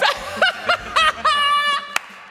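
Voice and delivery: laughing excited shout. Real, not acted. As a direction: shout it through laughter in awe. Amazed at teammate's success.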